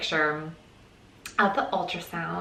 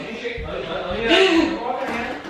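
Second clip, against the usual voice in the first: nervous laughter; 4 semitones higher than usual. Real, not acted. Nervous before test results.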